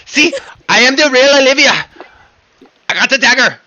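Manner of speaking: high, loud triumphant proclamation; insistent impostor. Acted. Player pulls out a dagger as Olivia, high insistent claim. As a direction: announce it like acted proof of who you are.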